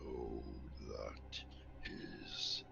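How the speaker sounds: low, slow whispered spirit; slow stone monotone. Acted. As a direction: breathe it out like an ancient spirit. GM voices sphere being.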